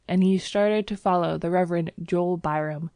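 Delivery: plain reading voice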